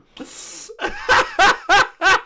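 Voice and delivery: squealing high-pitched laugh; long, high burst of laughter; high shrieking laugh. Real, not acted. Laughs at joke.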